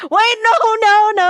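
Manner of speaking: high, loud string of no's; panicked denial. Real, not acted. Panics at being overheard.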